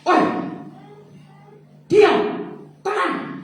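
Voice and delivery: high, loud barking command; sharp rebuke. Retold or imitated. Jesus rebuking the storm, sharp loud high command. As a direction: snap at the storm to be still.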